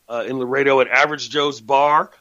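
usual speaking voice

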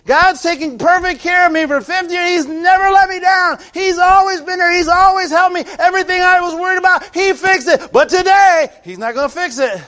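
high, loud mock rant of a worrier; mock worried shout. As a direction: shout it like someone who makes no sense. Mocking the worrier's insane logic.

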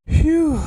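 loud sharp relieved phew; big exhale. Real, not acted. Streamer to chat, a loud relieved phew. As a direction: blow out the tension.

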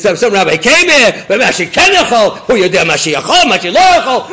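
loud, high, frantic yelling; panicked screaming. Acted. Officer raving to his superior by phone, panicked screaming. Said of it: "he starts screaming into the phone".

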